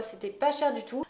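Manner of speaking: usual speaking voice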